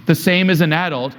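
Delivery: ordinary talk, nothing marked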